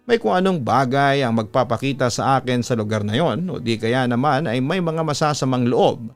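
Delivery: ordinary delivery, nothing marked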